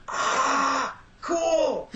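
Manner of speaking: very high, starstruck; squeaky mock-awed cool; awed reaction. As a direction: gush like a stunned fan. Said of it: "Clayton and I went".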